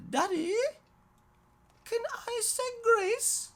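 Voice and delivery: put-on high, bright child's voice asking permission; eager little girl. Daughter asking daddy at dinner if she can say grace, high and eager. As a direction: ask daddy like an excited small girl.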